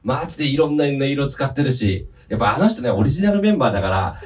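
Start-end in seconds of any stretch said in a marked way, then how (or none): none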